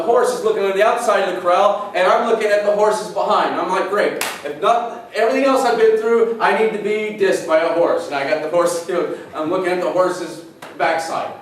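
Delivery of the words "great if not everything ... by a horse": dry mock disgust; sarcastic. Pastor retelling being snubbed by a horse, sarcastic. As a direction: roll your eyes at the horse. Horse turns its back on him.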